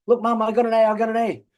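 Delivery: put-on high, bragging child calling out; excited kid voice. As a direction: shout it like a proud little kid. Siblings boasting to mum.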